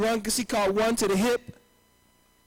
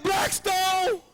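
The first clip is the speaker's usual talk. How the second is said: shouting; 10 semitones higher than usual. Acted. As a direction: yell the gang name out.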